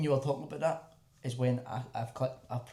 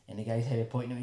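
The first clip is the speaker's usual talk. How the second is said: ordinary delivery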